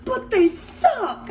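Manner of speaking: high, slow words broken by laughter; laughing retort. Retold or imitated. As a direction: laugh it out as you blurt it. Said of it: "And I'm like".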